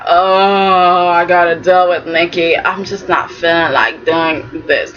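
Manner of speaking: a hint of a tired old man; reluctant Victor. Victor dreading dealing with his wife Nikki, low weary voice.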